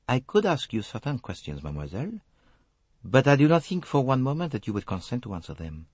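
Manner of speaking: put-on measured French-accented detective; Hercule Poirot. A narrator's reading. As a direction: choose the words carefully in a French accent. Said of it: "Poirot spoke slowly, choosing his words".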